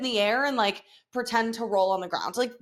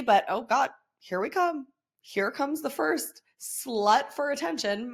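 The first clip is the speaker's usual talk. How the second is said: ordinary talk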